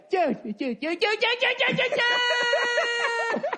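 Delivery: rising, climbing cry of Jude into a shout; mock-dramatic wail that builds to a shout; high-stakes emotional impression. Friend mocking a buddy's emotional impressions, building cry. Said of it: "Every impression was a different, like, high-stakes emotional thing".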